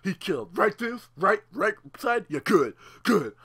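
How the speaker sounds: mock deep Kratos approving. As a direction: rumble it like a satisfied war god. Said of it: "Kratos is looking down".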